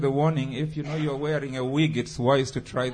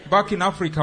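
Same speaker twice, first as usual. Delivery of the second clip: ordinary talk, nothing marked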